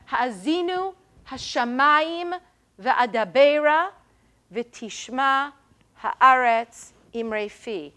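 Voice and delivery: one stressed beat on each of "Ha'azinu, Hashamayim, Va'adabera, V'tishma, Haaretz, Imrei Fi", evenly spaced with pauses; balanced meter. Shown as a demonstration. Teacher showing biblical meter to the class, balanced stresses on "Ha'azinu, Hashamayim, Va'adabera, V'tishma, Haaretz, Imrei Fi". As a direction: recite "Ha'azinu, Hashamayim, Va'adabera, V'tishma, Haaretz, Imrei Fi" as two matched halves, three separate beats each. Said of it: "It's very balanced".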